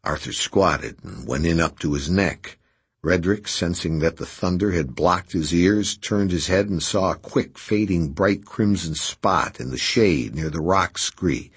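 ordinary delivery

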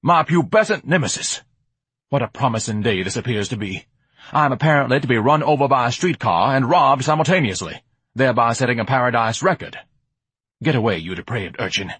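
grandiose, theatrical indignation; pompous blustering Ignatius. Acted. Ignatius rails at the depraved urchin, pompous bluster. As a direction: declaim it like an affronted grandee.

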